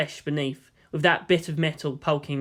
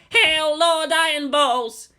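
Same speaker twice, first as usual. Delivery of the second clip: much higher, slow triumphant hail; crowed; 11 semitones higher, 4 dB louder, slower than usual. Acted. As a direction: hail him like a herald. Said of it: "Kensuke crowed".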